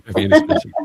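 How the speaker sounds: very high, loud, silly squeak; squeaky joke voice. Pretend. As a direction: squeal the question like a cartoon. Joking banter with laughter.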